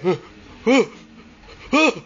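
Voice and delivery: breathless gasping huhs; panting huh. Retold or imitated. Narrator gasping as he faces the ghost. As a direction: gasp out each huh in fright. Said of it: "I was like".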